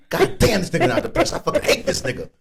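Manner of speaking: high, loud griping with laughter; frustrated hater. Retold or imitated. Rapper voicing a coworker annoyed he recovered, high and laughing.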